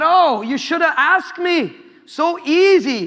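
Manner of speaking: a preacher's hammed-up Indian accent; light comic Indian accent; mock Indian accent. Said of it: "I don't know if that's an Indian accent".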